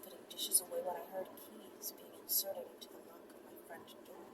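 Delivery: hushed, intimate storytelling voice; soft intimate narration; soft story reading. A narrator's reading. Reads erotic story softly with music.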